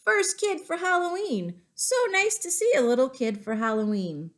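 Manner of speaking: hearty, high-pitched delighted greeting; man at door greeting a child, delighted; cheerful big man. Acted. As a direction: beam at the first trick-or-treater. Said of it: "A big man opened the door and said".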